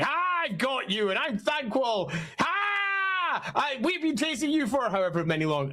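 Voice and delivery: high-pitched triumphant villain, crowing; hammed-up villain shriek on a long Ah; gloating Thanquol. Villain boasts to the pair he has finally captured, gloating and high. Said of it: "and he's like".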